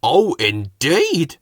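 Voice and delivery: slow, loud, unimpressed oh indeed; drawn-out oh indeed. A narrator's reading. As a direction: stretch it with disbelief. Fat Controller unimpressed.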